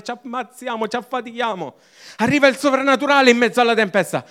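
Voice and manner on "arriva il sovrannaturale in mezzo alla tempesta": higher, louder and fast peak of the sermon; climactic proclamation. Real, not acted. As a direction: proclaim it as the turning point. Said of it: "preacher's climactic announcement". (proclamazione, più alta, forte e veloce)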